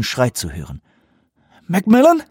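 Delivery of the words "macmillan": high, loud questioning call. Acted. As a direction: search for him. Searching for the soldier.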